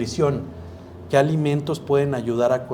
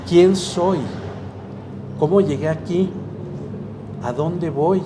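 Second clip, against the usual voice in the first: mock soul-searching; 5 semitones higher, slower than usual. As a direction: ham up the big existential questions. Philosophical questions voiced aloud.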